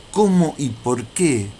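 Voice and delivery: ordinary delivery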